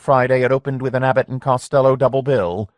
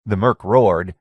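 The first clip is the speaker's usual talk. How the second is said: ordinary delivery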